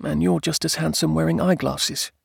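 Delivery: high-pitched, shy wife. A narrator's reading. Blushing wife compliments husband, shyly. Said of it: "she said shyly".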